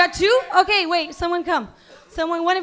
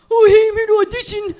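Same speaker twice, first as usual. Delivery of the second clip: higher, louder dopey voice; cross-eyed goof; 5 semitones higher, 7 dB louder than usual. Retold or imitated. Imitating a cross-eyed actor at an audition, dopey and loud. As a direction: play a clueless auditioner with crossed eyes. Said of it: "like this".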